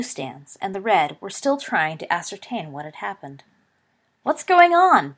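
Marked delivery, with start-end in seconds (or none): 4.2-5.1 loud, high bellowed question; bellowing. A narrator's reading. Broad-chested Ravenclaw bellowing to find out what happened, loud. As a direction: bellow it across the stands. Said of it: "bellowed a broad-chested Ravenclaw".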